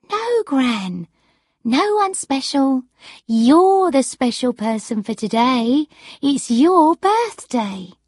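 high, emphatic granddaughter voice; reassuring granddaughter. Acted. Granddaughter telling gran it's her birthday, high reassuring voice.

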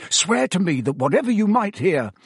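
pressing, anxious urging; urgent, hurried. A narrator's reading. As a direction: demand an oath with time running out. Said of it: "talking more quickly still".

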